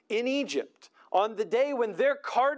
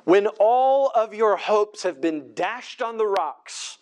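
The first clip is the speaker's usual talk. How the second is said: preaching at full force; 2 semitones lower, 5 dB louder than usual. Acted. Preacher warning the congregation about trusting Egypt, forceful. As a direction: drive each "not" home from the pulpit. Preacher presses the haunting question.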